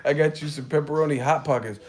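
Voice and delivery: sugary mom offering snacks; sweet mom. Retold or imitated. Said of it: "she was like".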